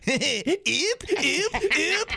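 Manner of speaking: repeated high mock-French yips; French accent. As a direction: yip like a bumped French tourist. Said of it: "Like forty French tourists in Milwaukee going".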